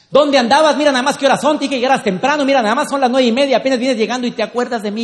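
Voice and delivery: loud, high, fast scolding rant; shouting husband. Acted. Husband greets wife coming home late, shouting. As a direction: yell an indignant where-have-you-been tirade. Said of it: "el hombre esté gritando en su papel".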